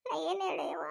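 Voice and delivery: crying out in distress; acted tearful despair; tearful lament. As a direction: wail it helplessly. Acted family grief over injured man.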